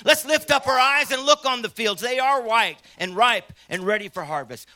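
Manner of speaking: loud, high, rousing sermon voice; fiery preaching. Acted. Preacher firing up baby boomers at the conference, rousing shout. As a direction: lift the room like a revival preacher.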